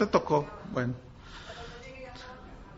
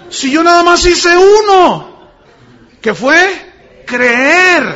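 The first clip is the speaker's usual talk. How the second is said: loud, high, drawn-out insistence; loud protest; 8 semitones higher, 10 dB louder, much slower than usual. Retold or imitated. Preacher retells his protest at forty steps, loud indignant insistence. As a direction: belt it out slowly, stretching the key words.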